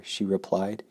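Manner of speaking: ordinary delivery, nothing marked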